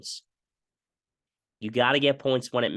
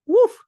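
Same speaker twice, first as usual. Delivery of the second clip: 1 loud woof; 10 dB louder than usual. Real, not acted. Reaction to a hit.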